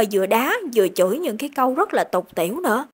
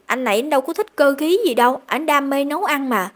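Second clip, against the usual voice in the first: ordinary delivery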